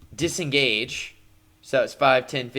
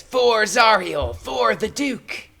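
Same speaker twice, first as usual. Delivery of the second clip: much higher, slow fanatical cry; zealot's battle cry; 9 semitones higher, 5 dB louder, slower than usual. Acted. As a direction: cry it out as a devoted cultist striking. Cultist's battle cry while striking.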